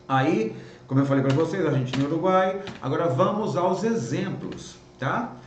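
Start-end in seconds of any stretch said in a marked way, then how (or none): none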